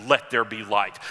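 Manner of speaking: voice of God. Retold or imitated. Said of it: "you hear a voice".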